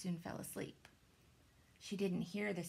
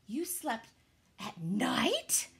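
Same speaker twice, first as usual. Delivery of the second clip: gasping; 3 semitones higher, 6 dB louder, much slower than usual. A narrator's reading. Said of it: "gasped another".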